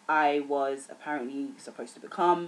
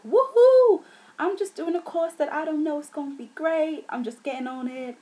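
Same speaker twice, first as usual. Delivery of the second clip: put-on high, excited American accent with a loud whoop; breezy American accent, much higher than usual; 12 semitones higher, 8 dB louder than usual. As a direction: put on a gung-ho American voice, pitched way up. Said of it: "why I did an American accent".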